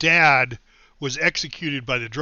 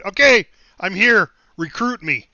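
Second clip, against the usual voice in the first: confident announcement; 4 semitones higher, 7 dB louder than usual. Retold or imitated. Bold walk-in at recruiting office.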